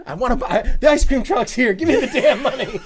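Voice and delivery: high mock little-girl demand, laughing; demanding toddler. Daughter wants ice cream money, high laughing demand. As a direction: play a pushy kid through laughter. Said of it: "she would come up to you and be like".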